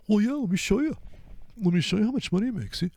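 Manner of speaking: high, fast show-off pitch; cocky trader. Acted. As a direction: boast quickly to the doubter.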